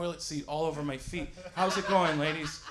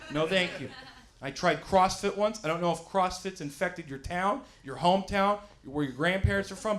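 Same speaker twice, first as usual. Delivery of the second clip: usual speaking voice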